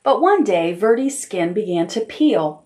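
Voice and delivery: usual speaking voice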